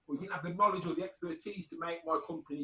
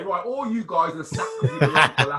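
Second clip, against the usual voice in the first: mock boss firing staff; 10 dB louder than usual. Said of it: "you can't just go into a boardroom and say".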